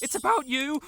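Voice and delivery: the secret escapes in a shout; blurted outburst. Acted.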